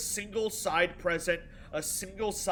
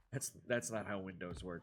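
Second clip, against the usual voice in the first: lowered mock voice; 8 semitones lower, 9 dB quieter than usual. Repeating complaint in new voice.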